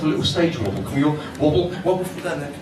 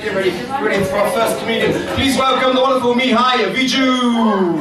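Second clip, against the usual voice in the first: announcer intro; 7 semitones higher than usual. Acted. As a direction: bring him on like a ringmaster. Host announces comedian.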